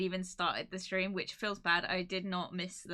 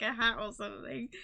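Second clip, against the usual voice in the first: ordinary talk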